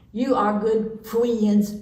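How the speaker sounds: slow "friends" as foo-WEE-ends; mispronounced friends. A narrator's reading. Parrot thanking the ducks, "friends" said as foo-WEE-ends. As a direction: stretch "friends" out, w for r. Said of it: "She couldn't say friend either".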